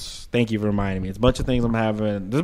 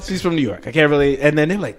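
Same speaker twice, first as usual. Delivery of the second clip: ordinary delivery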